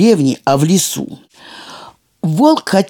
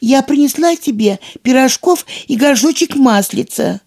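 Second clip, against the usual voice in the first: put-on thin girlish voice; 8 semitones higher than usual. A narrator's reading. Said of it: "тоненьким голоском девочки".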